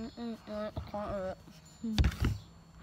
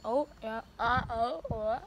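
ordinary talk, nothing marked

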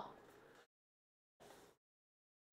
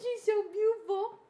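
cooing at cat; 8 semitones higher than usual. Real, not acted. Cooing about her cat.

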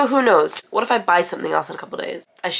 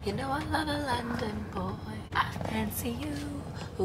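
mock flirt; 6 semitones higher, 11 dB quieter than usual. Playful flirt in put-on voice.

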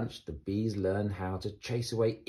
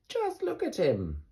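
lightly voiced scoffing bees; 10 semitones higher than usual. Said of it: "they said".

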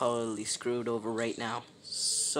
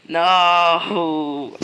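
despairing yell; loud outcry; 2 semitones higher, 11 dB louder than usual. Real, not acted. Caught in game, cries out.

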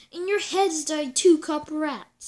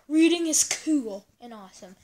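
ordinary talk, nothing marked

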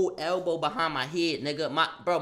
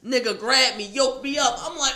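normal talking voice

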